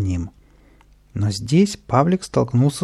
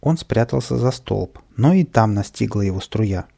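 ordinary delivery

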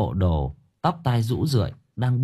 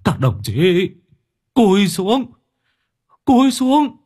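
loud, high warning shouts to comrades; old soldier screaming battlefield orders; frantic screaming; 10 semitones higher, 6 dB louder than usual. A narrator's reading. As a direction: yell out as if shells are coming in. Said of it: "miệng không ngừng gào thét".

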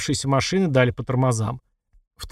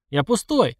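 shouting; 7 semitones higher, slower than usual. A narrator's reading. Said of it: "крикнул он".

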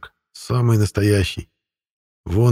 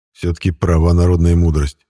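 plain reading voice

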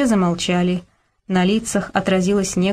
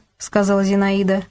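ordinary delivery

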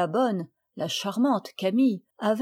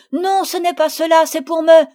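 loud, high, heated protest; fiery; 8 semitones higher, 11 dB louder than usual. A narrator's reading. Marguerite jumps to Camille's defence, fiery. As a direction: burst in hotly until cut off. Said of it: "Marguerite avec feu".

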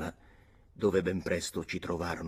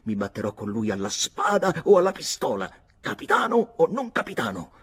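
angry cursing shout; 6 dB louder than usual. Acted. Jealous boy shouts his threat at Nora, loud and furious. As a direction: bellow the threat with a curse. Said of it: "urlai con una bestemmia".

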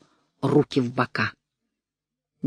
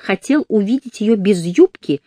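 sweet voice; 7 semitones higher, 4 dB louder than usual. Acted. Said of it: "сладким голосом продолжала волчица".

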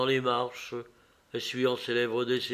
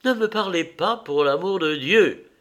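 high, louder exasperated outburst; angry cry; 6 semitones higher, 5 dB louder than usual. A narrator's reading. Man to the pestering woman, crying out angrily. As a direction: cry out to be left alone. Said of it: "cria Dorian".

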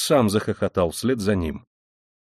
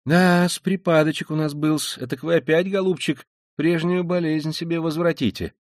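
high-pitched, fussing concern; friendly, fussy, high clucking; clucking sympathy; 7 semitones higher than usual. A narrator's reading. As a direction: cluck over him like a worried hen. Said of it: "закудахтал с дружественным участием".

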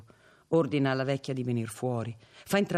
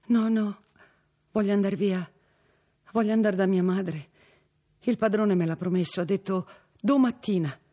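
anxious pleading; 7 semitones higher, 6 dB louder than usual. A narrator's reading. Frightened girl begging to leave.

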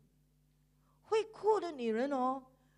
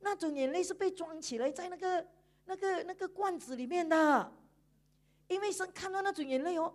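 ordinary delivery

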